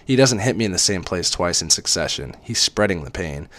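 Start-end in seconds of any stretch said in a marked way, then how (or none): none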